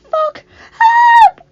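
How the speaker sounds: very high, loud shriek; piercing cry building in volume; panicked scream. Acted. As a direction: scream for your life. Hunted girl screams for help.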